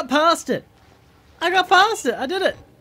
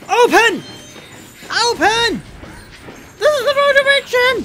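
desperate shouts, much higher and louder; panicked yelling; 9 dB louder than usual. Real, not acted. Trapped player yells at a locked door, panicked.

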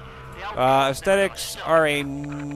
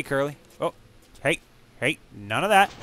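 usual speaking voice

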